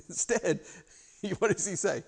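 chuckling softly through the words; laughing. Real, not acted. Preacher to the congregation, laughing quietly. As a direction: laugh quietly as you ask.

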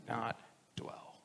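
a hushed emphatic close; whisper. Real, not acted. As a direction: drop to a breath for the last words. Sermon climax hushed.

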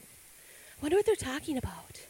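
hushed curious whisper; God's daughter whispering while peeking into the throne room. Retold or imitated. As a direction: whisper it from behind a pillar. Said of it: "hiding behind a pillar, peeking".

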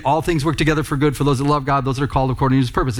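fast run-through of a familiar verse, hurrying to the point; rushed recitation. Shown as a demonstration. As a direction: rattle the verse off quickly. Recites verse minus its key opening.

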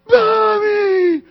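put-on shrill, high, loud lost-child scream. Lost little kid in Walmart screaming for mom. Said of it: "starts screaming".

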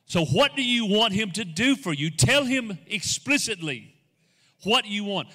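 high, loud, insistent exhortation; forceful preaching. Acted. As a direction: press the congregation, stretching the key words.

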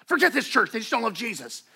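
imitating a sulking churchgoer; mock bitter churchgoer. Bitter member storming off.

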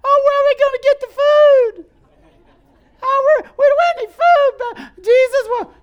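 shrill mock panic of the disciples; panicky disciples. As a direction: squeal it like panicked followers. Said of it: "what the disciples said".

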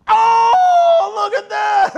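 acted awestruck shout, very high and loud; huge high-pitched cheer of amazement; shouting for joy. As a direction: whoop like an angel amazed at creation. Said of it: "they're shouting here".

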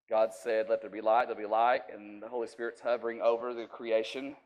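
ordinary delivery

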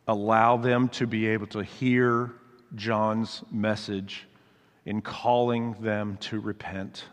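soft, earnest pastoral prayer. Acted. Pastor praying over the congregation, soft and earnest. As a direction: pray it quietly, with gentle conviction.

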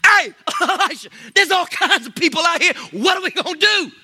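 high, laughing frantic servant voice; panicked servant. Retold or imitated. Preacher imagines himself as a panicked servant. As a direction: squeal it out, laughing in mock panic. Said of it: "I'd have been like".

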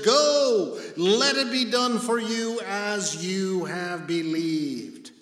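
high, booming scripture reading; loud proclamation. Acted. Preacher declaring Jesus' word to the centurion, loud proclamation. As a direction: proclaim it, peaking on GO, then stretch it out.

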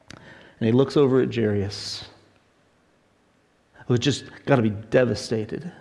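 normal talking voice

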